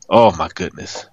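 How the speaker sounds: normal talking voice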